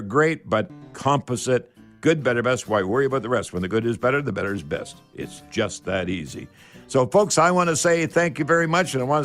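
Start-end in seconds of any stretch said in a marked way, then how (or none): none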